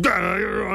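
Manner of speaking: high startled gah. Acted. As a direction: yelp in shock. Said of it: "the forest spirit said".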